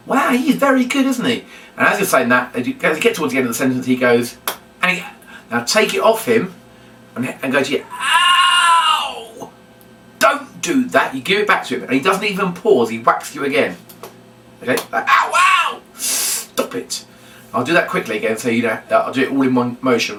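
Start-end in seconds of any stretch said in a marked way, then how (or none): 0.1-1.5 much higher, hammed-up wow; impressed. Shown as a demonstration. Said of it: "'cause you're impressed". 7.9-11.1 much higher hammed-up yelp of pain; mock ouch. Puppet whacks presenter with wand. 15.1-17.1 mock ouch. Puppet whacks presenter again.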